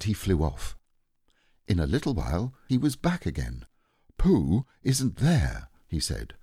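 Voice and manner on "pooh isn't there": slow, higher, weighty announcement; solemn Owl. Acted. Owl back with news of Pooh, slow and solemn. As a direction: report it slowly and importantly.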